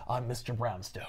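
low, soft menacing reveal; sinister villain. Retold or imitated. Villain revealing himself on the phone, low and menacing. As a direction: purr the villain's name darkly. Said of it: "And he goes".

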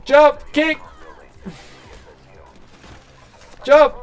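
loud urgent yells at the game character; shouted commands. Real, not acted. Streamer yelling jump and kick at his game character. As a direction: bark each command sharply.

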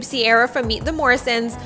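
normal talking voice

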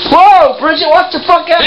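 high, loud startled cry; finding the wrecked candy, startled shout. Real, not acted. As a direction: react in shock. Surprised by smashed candy.

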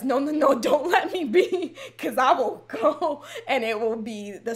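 high, loud mock plea through laughter; half-laughing insistent protest; mock pleading. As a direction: beg playfully not to be left, laughing. Mock plea to imagined partners, laughing.

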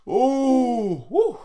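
talking through a big yawn. Real, not acted. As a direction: let the words stretch into a yawn. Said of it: "That was a big yawn".

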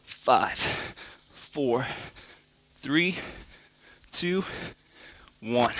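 quiet, strained counting mid push-up; counting workout reps. Real, not acted. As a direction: count reps under your breath while working. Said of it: "Starting to feel it a little bit".

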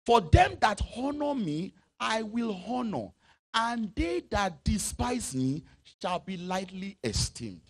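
slow, weighty reading; slow emphasis. Acted. Preacher reading the key verse slowly to the church. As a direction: lean on each word slowly.

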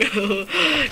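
brief amused giggle; short chuckle. Real, not acted. Gamer notices a double meaning, short chuckle. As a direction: chuckle at your own accidental innuendo.

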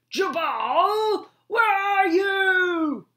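high, loud call across a storm; calling from afar. Acted. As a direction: rescuer calling for the frog through rain, loud far call. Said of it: "A faint voice called in the distance".

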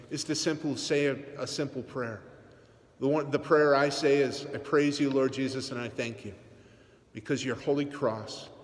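normal talking voice

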